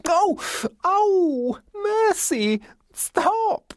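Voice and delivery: very high yelps of pain and pleasure; hammed-up, drawn-out squeals; shrill and gleeful. A narrator's reading. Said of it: "Arthur's voice was shrill and gleeful".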